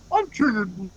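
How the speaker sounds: high-pitched whiny-meme character voice; Wilt. Acted. Friend playing Wilt complaining to Blue, high character voice. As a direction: put on a high cartoon voice. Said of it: "I think Wilt's obsessed with Blue's name".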